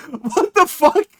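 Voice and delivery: high-pitched comic disbelief; exaggerated indignant squeal of a question; mock outraged reaction.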